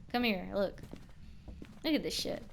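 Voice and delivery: ordinary delivery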